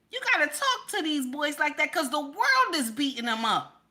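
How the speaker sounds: normal talking voice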